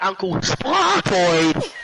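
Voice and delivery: loud, drawn-out holler that cracks; cracking catchphrase yell. Real, not acted. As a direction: holler it long until the voice breaks. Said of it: "Voice crack".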